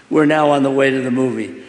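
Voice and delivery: usual speaking voice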